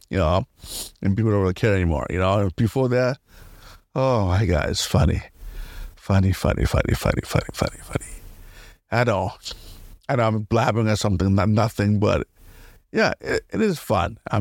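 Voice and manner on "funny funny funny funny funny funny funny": fading into whisper. Real, not acted. Giggly repetition fading away.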